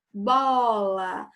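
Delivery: "Bola" with BO pushed and held long; stress on the first syllable. Shown as a demonstration. Teacher demonstrating the tonic syllable, BO stretched in "Bola". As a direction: lean hard on the "Bo" of "Bola". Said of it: "o bó é mais forte".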